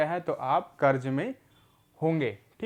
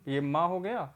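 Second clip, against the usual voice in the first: usual speaking voice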